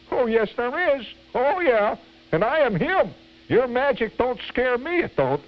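very high, bratty mock defiance; impotent vim. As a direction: talk back in a squeaky, hammed-up kid voice. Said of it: "with impotent vim".

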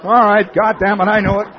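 loud guilty confession, cornered on Fifth Avenue; caught and giving up. Retold or imitated. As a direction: blurt it out loud like a caught man caving. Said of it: "You'd turn and say".

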